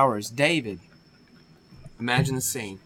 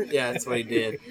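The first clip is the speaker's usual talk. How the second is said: ordinary delivery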